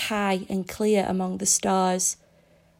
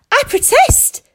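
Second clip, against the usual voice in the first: much higher, loud cry of protest; shouted protest; 10 dB louder than usual. A narrator's reading. Mead protests his arrest.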